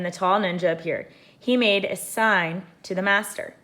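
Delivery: usual speaking voice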